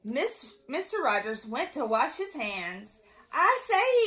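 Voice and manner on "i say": high, loud storybook call; raised-voice exclamation. A narrator's reading. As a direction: call out to get attention. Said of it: "he called".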